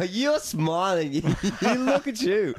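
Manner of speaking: high, laughing through the words; laughing tease. Real, not acted. Host teases co-host for smiling at joke, laughing. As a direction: crack up while pointing out his grin. Teasing co-host's smile.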